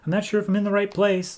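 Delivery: put-on high, quick little girl's voice. Girl calling at a stranger's door, high childlike voice. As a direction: imitate a lost girl calling, pitched high. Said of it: "girl's voice at the door".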